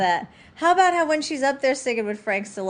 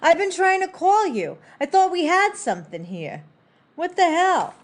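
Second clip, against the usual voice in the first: acted hurt indignation, pitched up; upset; 6 semitones higher than usual. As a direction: confront them like a jilted suitor, mildly hammed-up. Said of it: "He gets so upset with her".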